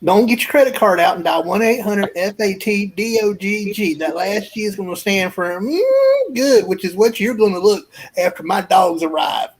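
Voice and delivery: folksy huckster reeling off the phone number; infomercial pitchman. Acted. Pitchman urges viewers to call for his dogs, hammed-up infomercial. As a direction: sell it like a homespun TV ad closer. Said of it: "infomercial that was done to that".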